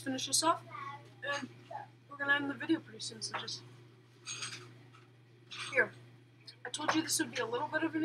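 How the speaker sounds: talking between sips; words into cup rim; speaking with cup at mouth. Real, not acted. Seen 1: Right speaker holds plastic cup at lips while talking.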